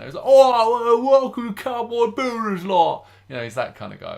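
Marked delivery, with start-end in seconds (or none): 0.2-3.0 much higher, louder Cockney TV host voice; Cockney accent. Retold or imitated. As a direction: greet the viewers in a big Cockney accent. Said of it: "He's got a right Cockney accent".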